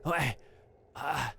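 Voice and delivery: a hammed-up sigh of defeat; defeated madcap. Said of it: "the first one there's like".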